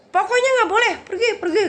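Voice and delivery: high, loud mock scolding; high-pitched, hammed-up angry order; imitated angry shout. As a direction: act out a man shouting someone away. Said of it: "marah-marah ke saya ya".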